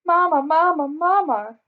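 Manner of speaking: high, loud parrot squawk repeating Mama; a bird crying out as the wind drags it away; struggling bird's cry. A narrator's reading. Parrot Al blown off course, crying to Mama Sarah, high and loud. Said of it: "the struggling bird cried".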